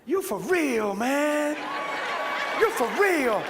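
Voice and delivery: loud, high Southern exclamation; imitated Southern amazement. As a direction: mimic a Southern man floored by it. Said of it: "Watch what he said".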